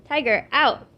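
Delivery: loud firm command; ordering the dog out, firm and loud. A narrator's reading. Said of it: "Emily said firmly".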